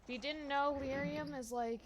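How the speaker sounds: normal talking voice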